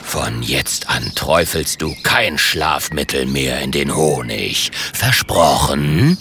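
tiefes, gedehntes Drohen eines Drachen; herrischer Drache. Acted. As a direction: fahr ihn tief und langsam an. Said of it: "herrscht Onkel Ingmar den Ritter an". (herrisch drohender, tiefer Drache)

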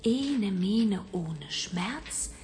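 slow, hushed spell chant; whispered spell. Acted. Bibi whispers a love spell for Manja, hushed chant. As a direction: breathe the spell out slowly.

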